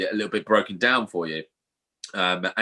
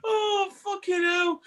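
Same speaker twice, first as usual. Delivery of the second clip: high, slow, tearful exclamation; real worn-out laughter in the voice; weak from laughing; slower than usual. Real, not acted. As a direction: sigh it out after hysterics. Recovering from laughing fit.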